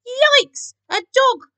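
high, loud cries of alarm; panicked squeal. A narrator's reading. Cats begging Keith to save them, panicked. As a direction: squeal urgently for help. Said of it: "squealed in panic".

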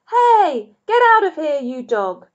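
high, loud yell at a dog; angry shout. Acted. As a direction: shoo the dog off furiously. Ernest chasing the dog.

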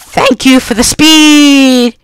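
high, drawn-out holler; exaggerated high-pitched shout of thanks; calling out. Real, not acted. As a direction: sing out the last word high and long. Calls out thanks in game.

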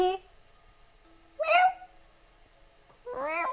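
very high squeaky meow; cat meow. Retold or imitated. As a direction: meow like a kitten. Imitates the cat.

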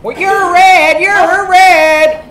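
high, loud mocking chant; taunting kid, imitated. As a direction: mimic a child's jeering sing-song. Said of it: "You know what she said to that?".

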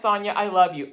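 usual speaking voice

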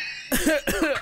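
high, strained cough of a dying man; a pained, choking cough. Acted. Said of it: "he's like".

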